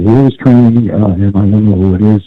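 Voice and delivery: normal talking voice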